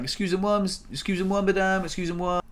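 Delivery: retold polite appeal in halting schoolroom French; attempted French. Wheelchair user calling to the neighbour, attempted French. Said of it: "Trying to speak French".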